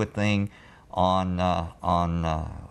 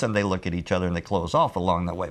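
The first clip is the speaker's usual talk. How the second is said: ordinary talk, nothing marked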